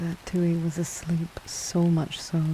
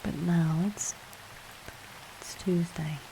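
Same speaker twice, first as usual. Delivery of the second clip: guided relaxation; 8 dB quieter than usual. Acted. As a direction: gently guide the breathing, hushed and calm.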